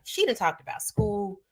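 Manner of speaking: high, quiet mock audience voice, hushed. Speaker mocks listeners tired of hearing about school. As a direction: imitate listeners grumbling under their breath. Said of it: "y'all are probably like".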